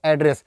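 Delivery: "एड्रेस" with an open front vowel first; noun pronunciation. Shown as a demonstration. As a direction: say "एड्रेस" as AD-ress. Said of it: "जब हम पता बताएंगे". ("एड्रेस" खुले "ऐ" से शुरू)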